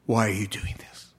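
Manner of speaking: whispered in disbelief; awed whisper. Retold or imitated. The man whispering his amazement at the bishop's mercy. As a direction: breathe the question in wonder.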